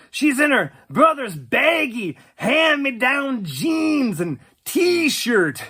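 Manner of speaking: ordinary talk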